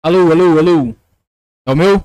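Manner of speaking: loud mic test, clipping; too loud, audio breaking up; clipping distorted mic. Real, not acted. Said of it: "estourou você dando uma empolgada".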